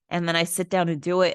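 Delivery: ordinary delivery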